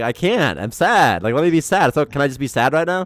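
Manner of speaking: hammed-up upset kid asking to be sad; pleading sad kid. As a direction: beg like a grieving kid to be allowed to cry. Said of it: "You'd be like".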